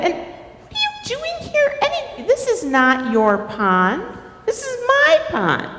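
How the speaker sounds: high-pitched territorial protest; indignant turtle. Acted. Turtle confronts Beaver over the pond, indignant. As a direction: pitch it up and stake a claim. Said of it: "Turtle's like".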